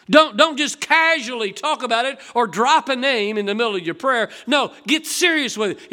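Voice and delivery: loud, high urgent preaching; fervent preaching. Acted. Preacher to congregation, loud urgent exhortation. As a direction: urge them to pray seriously.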